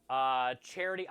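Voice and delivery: ordinary talk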